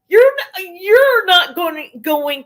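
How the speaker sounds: rising furious rant; angry outburst. Real, not acted. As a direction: hit it hard, indignant. Ranting at absent woman.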